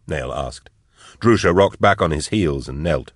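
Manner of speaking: ordinary delivery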